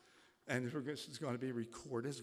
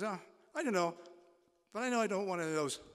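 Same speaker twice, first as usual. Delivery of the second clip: put-on kid voice; 7 semitones higher, 9 dB louder, much faster than usual. Child voiced in a joke.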